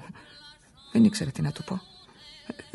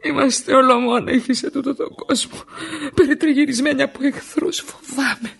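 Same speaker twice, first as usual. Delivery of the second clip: higher and louder, pleading for help; wife begging her husband Aris for help in fear; desperate fear; 5 semitones higher, 9 dB louder than usual. Acted. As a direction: plead as if at the edge of panic.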